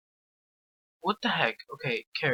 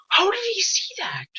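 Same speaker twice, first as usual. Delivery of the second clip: disbelieving; 4 dB louder than usual. Real, not acted. Baffled watching another player.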